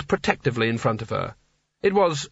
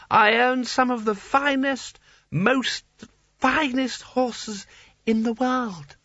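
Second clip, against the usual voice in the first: much higher, dreamy boast; tipsy Seraph; 10 semitones higher, slower than usual. A narrator's reading. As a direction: float the word up proudly.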